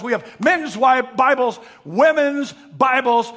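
loud, high, driving sermon cadence; full-force preaching. Real, not acted. Preacher listing Bibles we have, full-force preaching. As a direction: hammer out the list at full voice.

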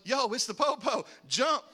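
high, loud mock-panicked warning; retold alarm shout. Teen to buddy as the police arrive, alarmed shout. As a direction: shout it to get your friend moving.

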